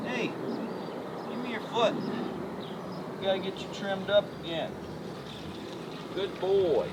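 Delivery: soft, fast coaxing to the horse. Real, not acted. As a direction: ask for the hoof gently. Asking horse for foot.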